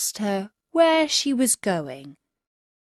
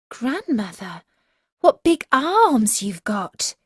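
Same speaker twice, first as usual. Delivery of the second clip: put-on amazed girl; 3 semitones higher, 3 dB louder than usual.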